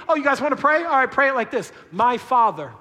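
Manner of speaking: lightly voiced casual Jesus, high and loud; mock wrong version. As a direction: toss off "My Father" breezily. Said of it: "he didn't say".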